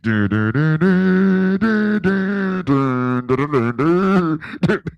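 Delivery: loud wordless mouthed movie score; hummed film theme. Retold or imitated. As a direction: sing the soundtrack in da-da syllables. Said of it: "Here come the music from".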